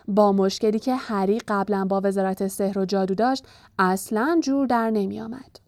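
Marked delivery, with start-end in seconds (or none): none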